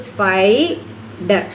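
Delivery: slow, syllable by syllable spider; syllable split. Shown as a demonstration. Teacher to students, slow split into syllables. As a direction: spell it out slowly, spi-der. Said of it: "D will go with the second one".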